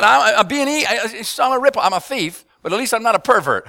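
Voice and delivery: loud, high mock protest; retold indignant outburst. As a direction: bluster that at least you're no pervert. Prisoner loudly justifies himself.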